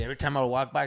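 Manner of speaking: ordinary talk, nothing marked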